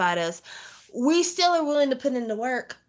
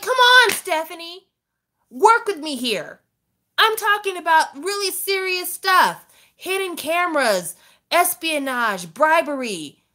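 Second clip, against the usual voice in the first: higher, louder pleading; exasperated pleading; 8 semitones higher, 4 dB louder than usual. Retold or imitated. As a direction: beg her to take it seriously. Pleading with daughter.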